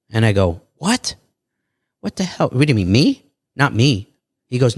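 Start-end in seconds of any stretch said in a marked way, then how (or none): 0.8-4.1 high, alarmed disbelief; shocked "what". Retold or imitated. Hearing he'll be on Sixty Minutes, shocked and high. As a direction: recoil at the news, much higher.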